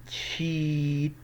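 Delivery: "تشيت" with the ee held very long; long EE sound. Shown as a demonstration. Teacher showing learners the long vowel, "تشيت" drawn out. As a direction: stretch out the ee in "تشيت". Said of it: "الصوت هنا طويل شوية في الإي".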